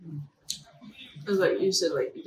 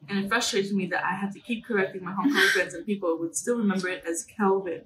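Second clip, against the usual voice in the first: ordinary talk, nothing marked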